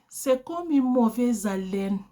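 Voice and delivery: ordinary talk, nothing marked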